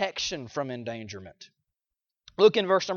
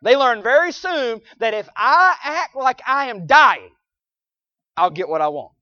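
loud high preacher delivery; preaching at full force; 6 semitones higher, 9 dB louder than usual. Real, not acted.

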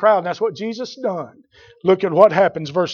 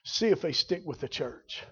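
much softer, dropped low; quiet; 3 semitones lower, 12 dB quieter than usual. Acted. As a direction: say it almost under the breath before repeating. Repeats line quiet then loud.

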